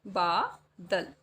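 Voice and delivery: बादल stretched slowly syllable by syllable; dictating बादल slowly; slow spelled-out word. Shown as a demonstration. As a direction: say बादल slowly for children to write. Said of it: "किस तरीके से लिखेंगे?".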